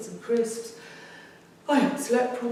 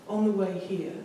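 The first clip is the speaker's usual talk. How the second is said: ordinary talk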